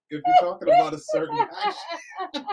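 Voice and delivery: high-pitched laughing talk; words through giggles. Real, not acted. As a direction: explain while still giggling. Still laughing while explaining.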